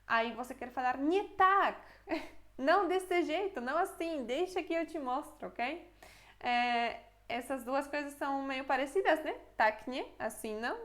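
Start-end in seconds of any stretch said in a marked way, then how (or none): 1.0-5.9 higher, louder, impatient correction; correcting someone. Acted. Watching someone fry pancakes wrong, impatient correcting. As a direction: stop them mid-flip and take over.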